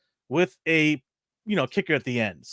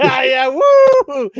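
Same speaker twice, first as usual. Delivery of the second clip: excited whoop; 13 semitones higher, 11 dB louder than usual. Real, not acted. Cheering the old show.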